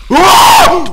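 very high, loud shriek of fright; terrified scream. Real, not acted. Gamer ambushed by a mob, loud terrified shriek. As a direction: scream at the jumpscare. Sudden creeper jumpscare.

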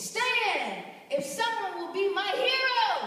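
rally the room, slow and strong; preaching. Acted. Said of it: "let's have church in here".

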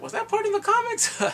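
high, startled question; surprised question. Retold or imitated. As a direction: squeak it up high in disbelief. Own surprised reaction retold.

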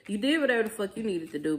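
usual speaking voice

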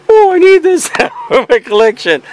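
high, pleading whine with a laugh inside; mock AJ pleading. Imitating AJ begging Anthony for his games back. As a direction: beg like a desperate collector. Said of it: "And he'll be like".

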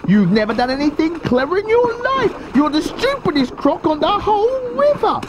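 high-pitched little-croc accusation; shrieky-high croc reproach; scolding small crocodile. A narrator's reading.

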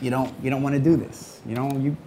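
a hint of a fretful grandma, nudging.

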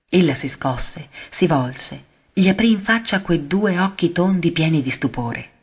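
ordinary delivery

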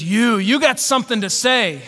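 put-on loud drunken challenge; lightly acted loud drunk; mock drunk. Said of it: "he's still drunk".

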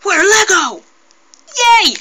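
high squealing cheer; excited cheer. Pretend. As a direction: cheer like a thrilled toy. Figures cheering.

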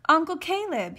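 high, loud call to uncle; hollering. A narrator's reading. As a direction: holler for your uncle over a crowd. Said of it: "he hollered".

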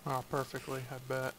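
ordinary talk, nothing marked

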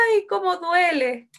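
high, drawn-out mock cry of pain; grito de dolor. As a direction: wail slowly, imitating a hurt child. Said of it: "Y gritaba". (quejido agudo de dolor, imitado)

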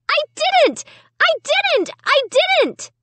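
very high repeated wail. A narrator's reading. Amy wailing denials at her family, very high.

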